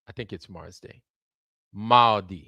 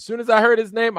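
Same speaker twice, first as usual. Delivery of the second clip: excited; 9 semitones higher, 7 dB louder, faster than usual. Real, not acted. Excited recognition of the name.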